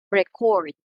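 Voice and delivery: "record" as re-CORD; verb stress. Shown as a demonstration. Teacher models the verb for students, "record" stressed on second syllable. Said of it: "when we talk about the verb".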